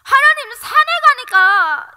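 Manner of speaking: loud, high, drawn-out complaint; angry at God. Retold or imitated. Prisoner complaining to God, angry pleading. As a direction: cry out in protest to God. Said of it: "막 화가 나는 거예요".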